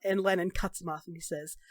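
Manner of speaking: usual speaking voice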